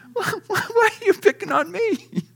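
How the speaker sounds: high, whiny and hurt; whiny Moses. Acted. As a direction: play Moses as a sulky complainer.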